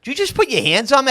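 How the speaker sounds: high, fast, affronted demand; indignant challenge. Retold or imitated. Confronting the man who shoved him, high indignant challenge. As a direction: snap it out like you can't believe he touched you.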